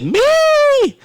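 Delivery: put-on squeaky high, loud kid's cry; shrill eager kid volunteering; the MC mimics a child shouting to play the game; little child. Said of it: "I heard a little child said".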